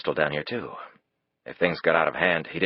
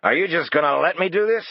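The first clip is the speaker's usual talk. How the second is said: much higher, loud angry shout; 12 semitones higher, 5 dB louder than usual. A narrator's reading. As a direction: yell at her in despair. Lee still roaring.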